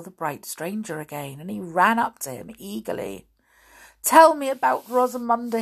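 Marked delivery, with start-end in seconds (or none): 4.0-5.6 higher, louder opening, urgent questions; eager cry. A narrator's reading. King begging the stranger for news of Rosamunda, eager cry. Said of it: "he cried".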